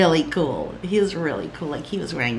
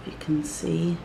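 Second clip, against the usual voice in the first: whisper; 6 dB quieter than usual. Real, not acted. Showing product close up.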